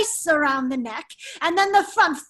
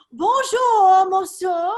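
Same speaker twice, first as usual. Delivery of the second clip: French greeting; 4 semitones higher, 5 dB louder, slower than usual. Acted. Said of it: "As the French say".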